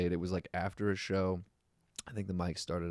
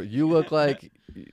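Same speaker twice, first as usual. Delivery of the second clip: raised, slow mock roast; heckler's shout; 7 semitones higher, 7 dB louder than usual. Audience member roasting the comic on stage, shouted out. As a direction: holler it out as an imitated heckler. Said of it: "somebody would shout out".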